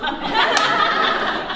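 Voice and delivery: high, loud burst of laughter; real laugh. Real, not acted. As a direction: laugh at your own joke. Laughs at own joke.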